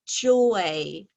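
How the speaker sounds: slow, deliberate word call. Shown as a demonstration. Teacher announcing the spelling word to the class, slow. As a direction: announce the word slowly and clearly. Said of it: "a teacher reading the word list".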